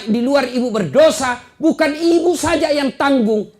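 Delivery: high, loud fiery sermon; full-force preaching. Real, not acted. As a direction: preach it hard to the mothers.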